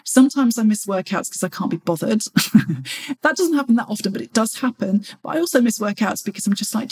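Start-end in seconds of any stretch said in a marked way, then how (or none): 2.3-3.2 a quick low chuckle at herself; short laugh. Real, not acted. Laughing at her own lazy excuse. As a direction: laugh it off, a little sheepish.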